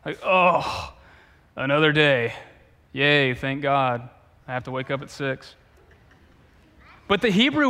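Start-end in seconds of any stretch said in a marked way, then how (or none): 0.2-5.5 mock morning groan. Shown as a demonstration. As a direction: groan like the alarm just went off. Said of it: "what I do when my alarm goes off".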